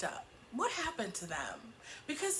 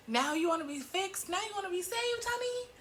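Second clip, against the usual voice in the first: mock incredulity; 9 semitones higher than usual. Mock incredulous retort to the character.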